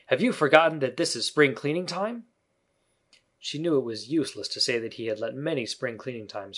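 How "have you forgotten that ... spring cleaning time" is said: put-on louder, higher boyish reproach; mildly stern reminder; a little stern. A narrator's reading. Peter reminds Wendy of spring cleaning, a little stern. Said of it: "He added a little sternly".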